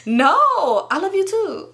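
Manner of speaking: giggly, rising pretend sweetness; fake lovey laughter. Covering for his insults, fake giggly affection. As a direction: laugh through it as if he said something lovely. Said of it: "pretend like I'm not getting cussed out".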